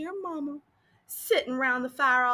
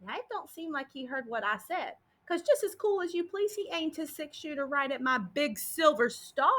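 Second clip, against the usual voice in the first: ordinary delivery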